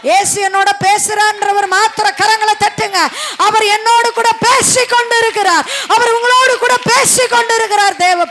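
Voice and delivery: climbing, full-voiced preacher's shout; rousing preaching. Real, not acted. Preacher calling believers to clap, rousing shouted preaching. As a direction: rouse the crowd with a ringing declaration.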